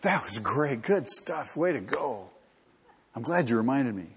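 a hint of breezy, offhand sarcasm; mock casual Jesus. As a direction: play the absurd, easygoing version. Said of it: "And Jesus says".

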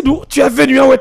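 usual speaking voice

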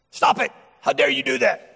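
put-on sharp high scolding shout at a child; angry parent. Parent scolding a disobedient child, angry shout. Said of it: "might raise their voice in anger".

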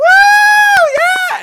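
very high, loud celebratory whoop; imitated cheering. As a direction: whoop like a crowd applauding a landing. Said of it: "people are whistling".

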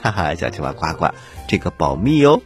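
usual speaking voice

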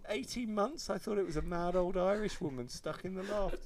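normal talking voice

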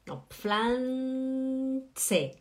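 Pflanze stretched very slowly; slow correction. Shown as a demonstration. Teacher corrects the learner, drawing out Pflanze very slowly. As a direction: draw out Pflanze, every sound clear. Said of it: "Presta atenção".

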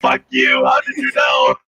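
deep, loud, booming robot shout. Acted. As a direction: yell it like a deep-voiced machine. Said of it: "put a robot voice on".

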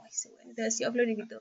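breathless whisper, mocking him; whispered sneer at a show-off; whispered mock disdain. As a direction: whisper it like a snide aside. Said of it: "cuando te conocí era como".

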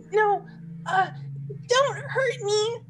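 high, slow fake pleading. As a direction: beg the monster, hammed up. Fairy feigns helplessness.

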